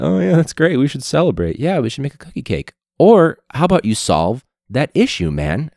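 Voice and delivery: high, loud sarcastic cheer; lightly mocking fake cheer; mock district staff. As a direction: ham up the fake enthusiasm. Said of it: "And they're like".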